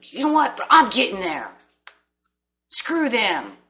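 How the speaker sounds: mock-fired-up "screw them"; defiant spark. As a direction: shrug it off and push forward. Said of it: "it's gonna build this".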